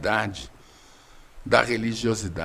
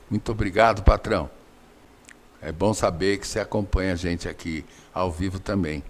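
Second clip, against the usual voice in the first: usual speaking voice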